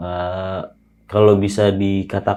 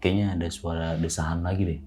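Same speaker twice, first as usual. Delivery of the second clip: softly, as if not to be overheard; hushed retold alert; 7 dB quieter than usual. Friend alerting half-asleep tentmate to noises, hushed. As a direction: quietly imitate a friend's discreet alert. Friend nudges him in tent.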